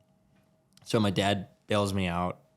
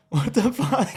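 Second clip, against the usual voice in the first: high, loud startled shout; reliving the moment the cop car appeared; shocked what the fuck; 13 semitones higher, 6 dB louder, slower than usual. Retold or imitated. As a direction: blurt it out in disbelief, voice jumping up. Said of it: "And I'm just like".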